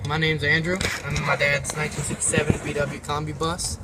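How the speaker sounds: ordinary delivery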